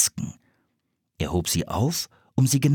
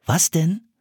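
interested; 7 semitones higher than usual. A narrator's reading. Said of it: "fragte Philipp interessiert".

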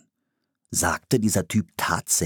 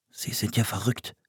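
softly voiced private thought; quiet, disbelieving aside; thought to himself; 3 semitones higher, 5 dB quieter than usual. A narrator's reading. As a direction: say it under your breath. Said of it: "dachte Philipp".

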